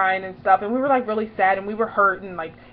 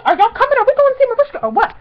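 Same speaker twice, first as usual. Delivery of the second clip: high, loud, rushed questions; frantic retold yell to hurry up; urgent calling out; 12 semitones higher, 5 dB louder, faster than usual. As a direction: call back to friends in a high, hurried shout. Retelling her urgent call while running.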